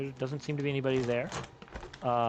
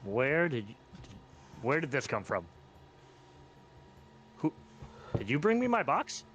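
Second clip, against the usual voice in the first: surprised goblin. Acted. Goblin tavern owner finds his box on the bar, surprised. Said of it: "and he looks down".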